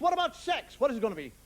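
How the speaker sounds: normal talking voice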